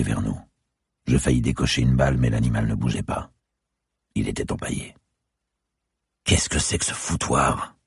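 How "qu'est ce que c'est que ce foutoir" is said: usual speaking voice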